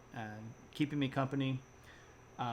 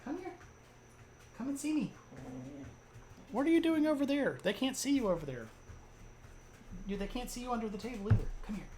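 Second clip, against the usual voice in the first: high-pitched pet-calling voice; high, cajoling dog talk; coaxing a dog; 9 semitones higher, 4 dB quieter, faster than usual. Real, not acted. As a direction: coax the dog over in a high voice.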